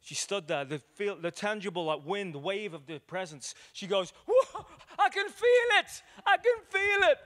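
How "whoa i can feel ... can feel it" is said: high, loud, amazed cry with a laugh; she's overwhelmed, voice leaping up; excited woman. Retold or imitated. Woman in bar feels presence.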